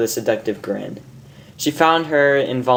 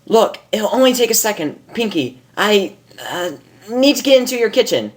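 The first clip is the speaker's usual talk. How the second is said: higher, hurried pony voice; Rainbow Dash voice; 9 semitones higher than usual. Acted. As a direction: rush it, trying to get past. Rainbow pleading in story.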